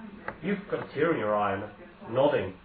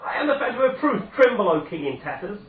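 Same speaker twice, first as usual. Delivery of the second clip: much higher, louder, laughing mad cry; triumphant shout; 8 semitones higher, 5 dB louder than usual. Acted. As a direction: roar it out in crazed triumph. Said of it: "The stranger shouts".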